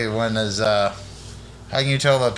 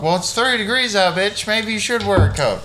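higher-pitched scolding comeback; mock exasperation; 7 semitones higher than usual. Co-host acting out an argument with his girl character, exasperated. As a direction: snap back at a silly question, put on.

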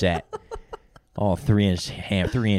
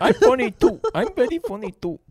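much higher squeaky whine; 5 dB louder than usual. Acted. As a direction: squeak it like a hurt child. Mimicking friend's mock voice.

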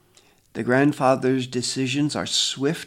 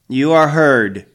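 stern elder; 3 semitones higher, 12 dB louder, slower than usual. A narrator's reading. Grandfather dismisses the accuser.

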